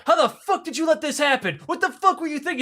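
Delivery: high-pitched, incredulous scolding; acted outrage. Kremlin officials berating Khrushchev over leak, acted outrage. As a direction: berate him in a strained high voice.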